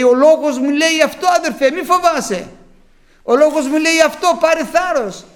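loud, high, fervent preaching; preaching at full force. Acted. Preacher urging the brothers, loud fervent preaching. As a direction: rouse the flock with the Word.

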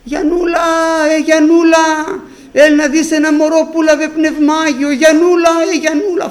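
high, loud calls across the village; shouting a name. Retold or imitated. Grandmother calling her neighbour to come see, loud shout. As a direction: yell for Giannoula at the top of the voice. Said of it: "βάζει κάτι φωνές".

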